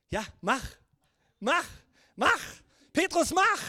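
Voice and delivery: mock cheerleading from the back of the boat; egging on. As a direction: urge him on in mounting bursts. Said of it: "wie der Rest der Jünger zu Petrus sagt".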